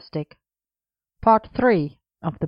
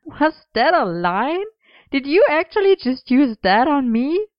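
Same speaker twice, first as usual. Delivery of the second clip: high, incredulous teasing; high-pitched mock astonishment; amused disbelief; 9 semitones higher, 3 dB louder than usual. A narrator's reading. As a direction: ask it incredulously, pitch swooping up. Merlin laughs in disbelief at line.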